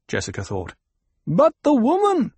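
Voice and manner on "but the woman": high, loud objection from above; raised voice, threatening; menacing call. A narrator's reading. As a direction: call it down with deadly intent. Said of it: "There had been death in that voice".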